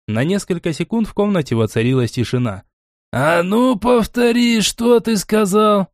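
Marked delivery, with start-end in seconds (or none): 3.1-5.9 high, slow, appalled demand; aghast. A narrator's reading. Gnome grabs his head at the bet, aghast. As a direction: make him repeat it, in high disbelief. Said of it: "взялся гном за голову".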